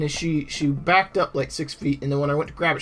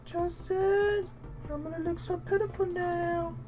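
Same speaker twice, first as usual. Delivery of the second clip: voiced dog; 5 semitones higher than usual. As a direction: whine in disappointment as the dog. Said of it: "infer what she might be thinking".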